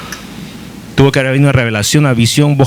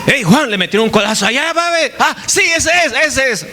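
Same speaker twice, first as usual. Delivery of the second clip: hammed-up crowd shouting and pointing, much higher; mock excited bystanders; 9 semitones higher than usual. Preacher mocking people who point at a dove, excited high shout. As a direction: play the gossiping crowd yelling at Juan.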